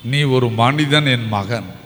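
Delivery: slow, low, gentle repeat; tender Jesus again. Acted. Preacher repeating Jesus's words to the outcast, slow and tender. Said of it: "என் ஆண்டவருக்கு".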